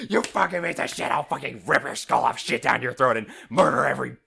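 loud, high imitation of a raging friend; hammed-up enraged shouting; mock furious threat. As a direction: overblown yelled tirade, imitating him. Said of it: "then you come back at me with".

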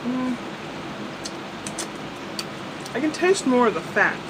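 chewing while talking; talking with food in mouth; mouth full. Real, not acted. Seen 0: Bacon bitten at -7; chewing, finger in mouth, then talks.

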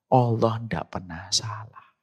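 usual speaking voice

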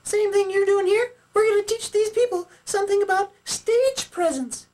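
very high, loud puppet voice; Sally puppet character. Acted. Sally greets Jeremy on stage, high puppet character voice.

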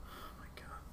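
real exhausted whisper. Real, not acted. Vlogger worn out by the news, whispering. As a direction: breathe it out, spent.

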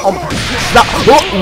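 normal talking voice

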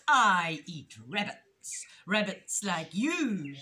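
loud, slow predator threat; raspy weasel closing in on prey; menacing rasp. A narrator's reading. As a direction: drag it out with villainous relish. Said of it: "she rasped".

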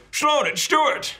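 high, drawn-out announcing yell; bursting-in shout. Acted. Stuart bursting through the shop door to greet Sloan, calling out. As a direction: burst in and call your friend, slowly and high. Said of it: "busts the door open and goes".

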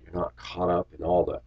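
usual speaking voice

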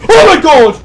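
sudden high yelp of fright; startled cry. Real, not acted. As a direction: jump out of your skin. Startled by something in game.

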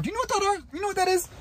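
high, mocking question; high sarcastic taunt. Real, not acted. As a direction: sneer it at the coach. Mocking the coach.

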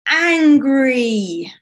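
long, loud, drawn-out "angry"; booming anger. A narrator's reading. Storyteller reveals Summer's feeling to children, booming anger. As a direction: stretch "angry" out with growing force.

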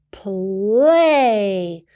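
put-on girl reading the sand; sounding out a word. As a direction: sound it out slowly like a girl learning to read. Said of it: "the girl sounded out".

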